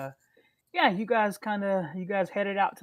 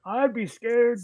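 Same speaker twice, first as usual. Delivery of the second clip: higher, louder mocking old man; 6 semitones higher, 7 dB louder, slower than usual. GM quoting the old man, higher and teasing. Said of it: "The old man that you talked to is like".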